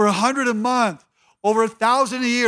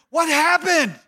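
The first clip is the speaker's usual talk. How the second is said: mock surprised shout; 7 semitones higher, slower than usual. Critics wondering aloud in mock.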